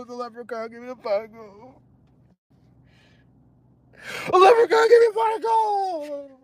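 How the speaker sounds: high, hammed-up sobbing wail; mock fake crying. Mocking Scott's tearful leprechaun story to viewers, fake crying. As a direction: blubber in a high, badly faked cry. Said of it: "well, fake crying. That's not real cries".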